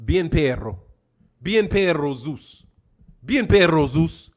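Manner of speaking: Bien perro said low and firm in Spanish; low, coaxing Spanish command to the dog. Shown as a demonstration. Coaxing the dog in Spanish, low and firm. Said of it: "how do you say this shit".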